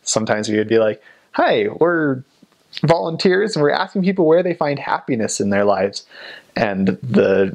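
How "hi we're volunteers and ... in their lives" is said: lightly imitated higher-pitched doorstep greeting; mock door-to-door pitch, voice lifted; missionary doorstep pitch.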